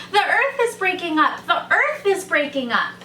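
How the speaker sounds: high-pitched storybook yell. A narrator's reading. Rabbit running off from the crash, yelling in alarm. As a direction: raise the alarm, high and urgent.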